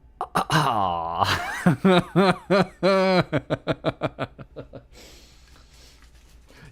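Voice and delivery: long loud cackling laugh; loud laughter. Real, not acted. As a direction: burst out laughing loudly. Laughs at her own joke.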